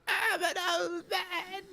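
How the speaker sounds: put-on high, slow, silly old man voice; stupid old man voice. Shown as a demonstration. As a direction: do a goofy old man voice through a laugh. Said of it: "sort of stupid voice".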